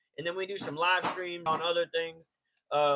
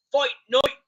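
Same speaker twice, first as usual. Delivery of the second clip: loud, high wrestler's accent impression; Sheamus; 7 semitones higher, 8 dB louder than usual. Streamer mimicking a wrestler's catchphrase, loud put-on accent. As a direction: shout it in a hammy put-on accent. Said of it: "Like Sheamus would say".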